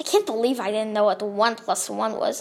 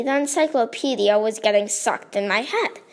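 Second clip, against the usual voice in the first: plain reading voice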